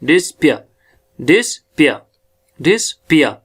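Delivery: "डिस्पियर" as dis, gap, pia; syllable demonstration. Shown as a demonstration. Teacher drilling the word, "डिस्पियर" split in two. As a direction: model "डिस्पियर" in two separate falling syllables. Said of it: "दूसरा syllable इसका डिस्पिया".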